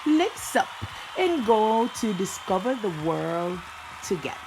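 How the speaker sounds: energetic YouTube host opener; vlog intro. Acted. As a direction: hype up the viewers for the video. Channel intro over music.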